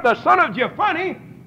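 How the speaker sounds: usual speaking voice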